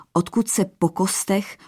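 usual speaking voice

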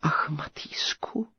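a breathy, tearful whispered sigh. A narrator's reading. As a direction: sigh his name in despair. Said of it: "vzdychla knížecí dcera".

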